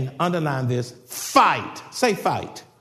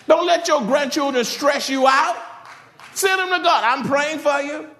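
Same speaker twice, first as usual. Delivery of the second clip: full-force preaching; 7 semitones higher than usual. Acted. Preacher exhorting grandparents to let go, fiery. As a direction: preach it hard at the congregation.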